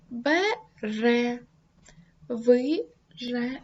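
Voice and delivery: слово «бере» і «вижени» по складах, повільно; розкладай «вижени» на склади повільно; syllable split. Shown as a demonstration. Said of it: "Наприклад". (вимова по складах)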